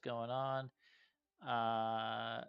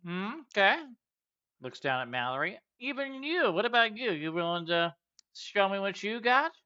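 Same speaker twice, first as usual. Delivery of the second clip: high, loud, swaggering challenger; Juwila, brash warrior host; 7 semitones higher, 6 dB louder than usual. Acted. Juwila challenging the party to a strength contest: loud swagger. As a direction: taunt them into the contest.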